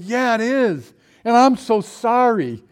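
loud, high, drawn-out apology; high loud apology to the boxer's owner. Retold or imitated. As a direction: plead sorry to the dog owner, slowing down. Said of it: "And I said".

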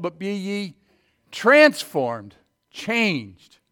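full-force Bible preaching. Acted. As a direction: thunder the verse out to the church.

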